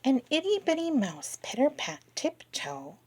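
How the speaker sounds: ordinary delivery, nothing marked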